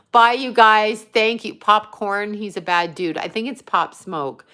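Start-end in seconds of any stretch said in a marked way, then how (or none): none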